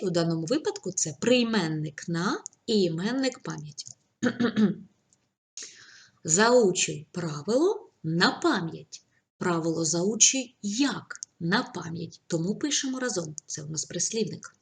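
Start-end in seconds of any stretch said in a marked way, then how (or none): 6.2-12.2 slow, even dictation pace; each word spaced for writing down. Shown as a demonstration. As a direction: read it out slowly so pupils can write. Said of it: "teacher dictating sentences slowly".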